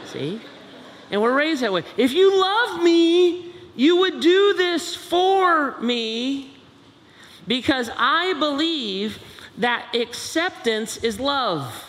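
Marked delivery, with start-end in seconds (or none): 2.0-11.8 high, slow drawn-out plea; milk it like a wheedling kid; child guilting parent into acceptance; pleading child. Acted.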